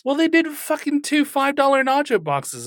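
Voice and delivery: high, loud exasperated outburst. Real, not acted. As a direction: blurt it out, fed up. Exasperated rant about nacho shortage.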